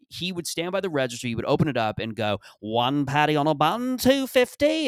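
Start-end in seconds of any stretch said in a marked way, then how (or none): 2.6-4.9 mock Australian accent, the last word up high; hammed-up Australian shopkeeper calling the price. Donut shop husband calling out the order, in a mock Australian accent. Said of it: "I am going to give him an Australian accent".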